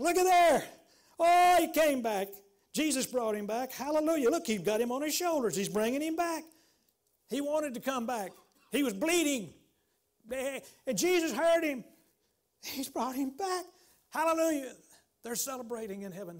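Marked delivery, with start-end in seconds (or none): 0.0-6.4 loud, high, jubilant angel cries; shouting angels. Acted. Angels rejoicing in heaven, shouting high and loud. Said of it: "the angels are shouting". 8.7-14.7 high, loud, fervent cries; ecstatic preaching. Real, not acted. Preacher exulting over the rescued sheep, loud and high.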